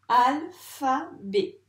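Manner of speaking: "Alphabet" slow and loud, split in three; syllable by syllable. Shown as a demonstration. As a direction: pronounce "Alphabet" as three separate beats. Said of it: "chaque rond pour chaque syllabe". (« Alphabet » lent et fort, découpé en syllabes)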